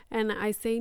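ordinary talk, nothing marked

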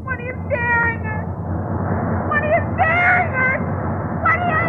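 high hysterical pleading; frantic. Acted. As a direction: shriek it in mounting terror. Wife changing into cat.

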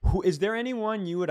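normal talking voice

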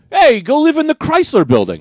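real estate broker voice. Acted. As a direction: pitch it like a slick broker. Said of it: "real estate guys who go".

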